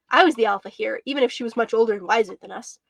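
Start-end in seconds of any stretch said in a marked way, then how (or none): none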